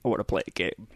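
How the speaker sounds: low, quiet creepy puppet voice; Jigsaw voice. Retold or imitated. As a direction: rasp it out like the Saw puppet. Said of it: "The Saw".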